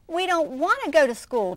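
little raccoon pleading with his mother; pleading cub. Acted. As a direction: beg like a small child who won't go. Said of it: "Chester told his mother".

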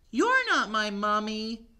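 put-on high little-child voice; high baby mummy. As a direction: say it like a small kid.

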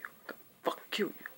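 fast menacing whisper of a threat. Retold or imitated. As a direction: hiss the threat under your breath. Retold threat from John.

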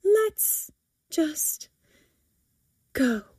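halting, high start dropping away; sighing. A narrator's reading. Mother wanting to leave the shop, sighing. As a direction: give up with gaps between words. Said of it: "Missus Havers sighs".

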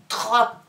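"Troc" loud, with a late separate K; emphatic dictation. Shown as a demonstration. Teacher redoing the syllable, loud with final K apart. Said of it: "On recommence une fois".

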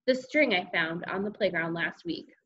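ordinary delivery, nothing marked

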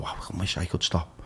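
softly spoken, almost under breath; quiet wish. Retold or imitated. Listener admitting the urge to quit, softly. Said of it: "part of people who are listening that go".

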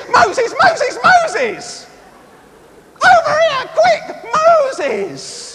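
very high, panicky squeal; worried, paranoid God. Acted. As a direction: call out in a mock panic for urgent help. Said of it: "God's worried and he's paranoid".

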